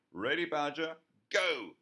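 loud, high shout. A narrator's reading. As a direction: shout go to start the heave. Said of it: "shouted the badger".

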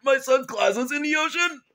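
high, scandalized dad outburst; appalled outcry. Acted. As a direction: cry out in hammed-up disbelief. Father appalled at thrown glasses.

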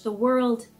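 usual speaking voice

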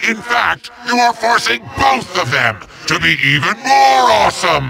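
usual speaking voice

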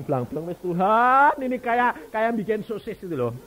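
loud, high, slow exasperation; exasperated plea. Retold or imitated. Stuffing intestines back, loud exasperated plea. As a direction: throw up hands at God loudly. Comic loud frustrated pleading.

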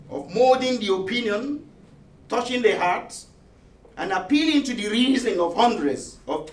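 rousing speech, pressed firmly; emphatic oratory. Retold or imitated. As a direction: declaim it as a proud press credo. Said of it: "argued pointedly and robustly".

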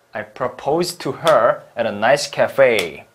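normal talking voice

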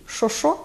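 Що-що said as "sho-sho", rising; doubled dialect question. Shown as a demonstration. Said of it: "повторюють декілька разів".

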